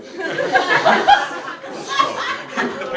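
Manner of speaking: high breathy giggle; cracking up mid-line; real laughter. Real, not acted. Breaks up laughing.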